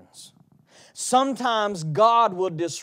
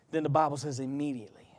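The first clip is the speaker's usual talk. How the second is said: hushed; 5 semitones lower, 5 dB quieter, much faster than usual. Acted. Hushed aside in sermon.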